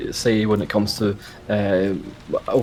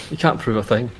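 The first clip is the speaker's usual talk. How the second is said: ordinary talk, nothing marked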